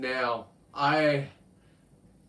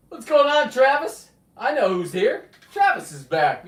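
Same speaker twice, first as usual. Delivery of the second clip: excited greeting; 11 semitones higher, 11 dB louder than usual. Real, not acted. Greeting a returning viewer.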